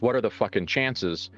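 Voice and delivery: ordinary delivery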